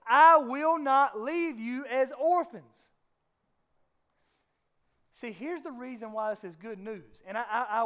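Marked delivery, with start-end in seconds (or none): 0.1-2.6 slow, loud, each word weighed; Jesus promising the disciples, slow and loud; emphatic promise. Retold or imitated. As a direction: preach it out, slowly and loudly. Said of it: "here's what he says".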